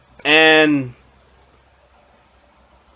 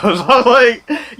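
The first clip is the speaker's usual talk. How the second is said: high, slow, laughing words; real laughing; 9 semitones higher, 4 dB louder, much slower than usual. Real, not acted. Storyteller amused by his own tale, laughing as he talks. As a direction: laugh through the words, drawing them out.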